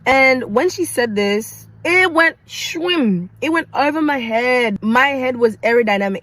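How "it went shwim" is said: high, slow drawn-out whoosh; whooshing sound effect. Retold or imitated. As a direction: sweep it past like a missile. Sound of remark flying overhead.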